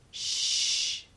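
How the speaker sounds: long, gentle hush; soft shush. A narrator's reading. Healer hushing a hurt boy, softly. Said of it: "she said softly".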